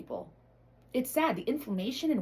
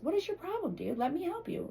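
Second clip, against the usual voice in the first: mocking the helper; 7 semitones higher, 3 dB quieter than usual. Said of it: "He goes".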